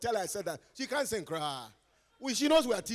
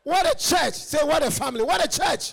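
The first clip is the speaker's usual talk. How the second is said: higher, louder praise shout; excited shout; 6 semitones higher, 8 dB louder than usual. Real, not acted. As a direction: cry it out to the church. Exclamation to congregation.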